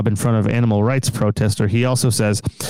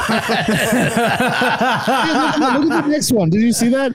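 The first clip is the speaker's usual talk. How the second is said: laughing; 12 semitones higher, slower than usual. Real, not acted. Laughing at the next filter.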